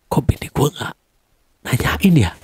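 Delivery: hushed whisper to himself; soft unvoiced musing; whispered thought. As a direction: whisper it like a private worry. Wondering to himself about wife.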